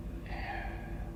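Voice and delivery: long, faint breathy sigh; soft exhale; real sigh. Real, not acted. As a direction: let out a quiet, drained breath. Sighs after telling the story.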